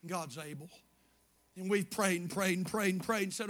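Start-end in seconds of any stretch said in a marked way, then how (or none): 0.1-0.7 much softer, lower repeat; quiet echo. Acted. Pastor echoing to his church, hushed and low. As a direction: let it sink in, hushed.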